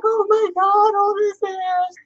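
high, drawn-out mock wail; hammed-up tearful lament in a very high voice; mock crying. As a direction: wail it like a distraught hairdresser, exaggerated. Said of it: "she was literally crying".

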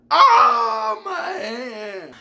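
high, loud cry of pain; pained old man scream. Retold or imitated. Narrator imitating the old man yanked by handcuffs, screaming. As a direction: shriek like your hand just got yanked.

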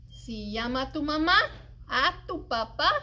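usual speaking voice

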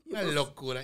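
ordinary delivery

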